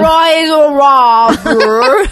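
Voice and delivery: loud, drawn-out bad Australian accent; badly faked Australian, bellowed high; hammed-up attempt at an Australian dad. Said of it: "in his Australian accent, which I will attempt".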